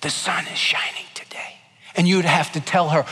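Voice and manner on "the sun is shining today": soft unvoiced whisper; teacher's whispered test line. Shown as a demonstration. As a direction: breathe the words like a hearing test. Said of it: "she'd whisper things like".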